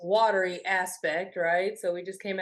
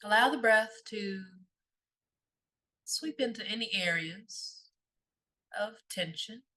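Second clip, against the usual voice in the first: softer meditation voice with long pauses; guided meditation; 3 dB quieter than usual. Real, not acted. Guide leading listeners through a body scan, calm meditation voice. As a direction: guide them gently inward, leaving long silences.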